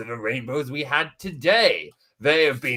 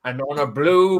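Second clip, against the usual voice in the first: usual speaking voice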